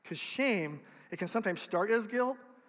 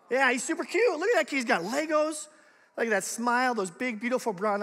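proud dad gushing about his son; 4 semitones higher, 4 dB louder than usual. Real, not acted. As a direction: coo over the photo like a proud dad. Gushing over son's photo.

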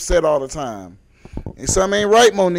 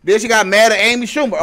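normal talking voice

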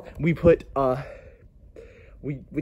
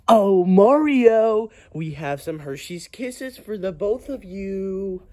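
drawn-out singsong offer; 5 semitones higher, slower than usual. Acted. Offering Mario and his friend Hershey's Kisses, slow high singsong. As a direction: draw it out in a high, coaxing singsong.

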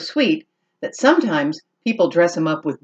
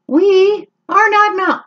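high, loud bird chorus; chirpy birds; 14 semitones higher, 5 dB louder than usual. Acted. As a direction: chirp it out together. Birds answer.

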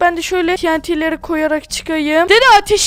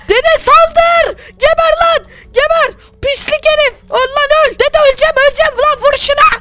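battle yell; 6 semitones higher than usual. Acted. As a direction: bark orders mid-fight at full voice. Grandson fights guards, yelling orders.

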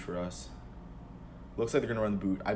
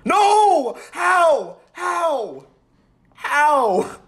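very high, loud disbelieving cry; despairing howl of disbelief; anguished shout; 11 dB louder, much slower than usual. Real, not acted. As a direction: scream in disbelief at the screen. Opponent scores late.

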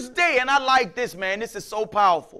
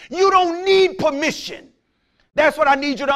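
a firm preached shout; preached declaration; 3 semitones higher, 5 dB louder than usual. Acted. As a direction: hammer each word to the congregation. Preaching at full force.

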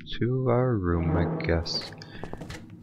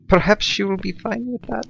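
higher, sing-song fake Swedish accent; 10 semitones higher than usual. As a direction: put on a bad Swedish accent. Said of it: "Was that even Swedish?".

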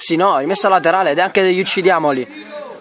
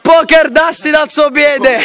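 goal commentary scream; 11 semitones higher than usual. Real, not acted. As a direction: lose it over a stunning goal.

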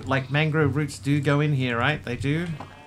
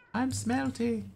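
high, drawn-out and soft; slow high croon; high singsong voice; 11 semitones higher, 5 dB quieter, slower than usual. Pretend. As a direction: stretch it out in a high, put-on little voice. Puts on voice after the word.

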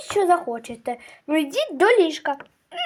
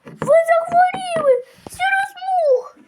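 much higher, shouting in alarm; alarmed cry; 10 semitones higher than usual. Acted. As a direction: cry out in panic over sick kids. Mother discovers sick kittens.

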